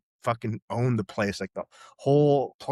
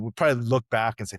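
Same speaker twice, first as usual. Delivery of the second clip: ordinary talk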